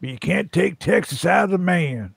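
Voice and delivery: hammed-up Texas accent. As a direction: put on a Texan twang for the saying. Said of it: "I've heard that about Texas".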